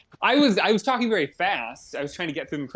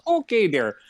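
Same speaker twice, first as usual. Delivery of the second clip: put-on Canadian accent; 4 semitones higher than usual. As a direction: lay on a cartoon Canadian accent. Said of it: "Keep the Canadian".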